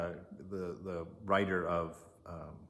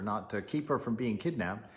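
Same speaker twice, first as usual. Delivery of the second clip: usual speaking voice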